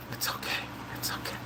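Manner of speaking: whispered comfort; soothing whisper. Retold or imitated. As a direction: whisper it like hushing a crying child. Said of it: "she's like".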